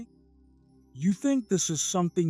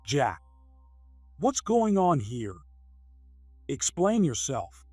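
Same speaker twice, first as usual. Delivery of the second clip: lower-voiced boss demanding answers; authoritative; 5 semitones lower than usual. Company president confronts his employee, authoritative. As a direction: take command and demand an explanation. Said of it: "His authoritative voice made Jack panic".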